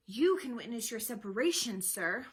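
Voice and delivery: loud, indignant outburst; angry roar. A narrator's reading. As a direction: roar it like an outraged father. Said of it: "Polixenes roared".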